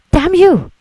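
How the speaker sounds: a high, loud furious curse; husband cursing the man who claims the affair; enraged outburst. Acted. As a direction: lunge at him with rage. Husband attacks wife's supposed lover.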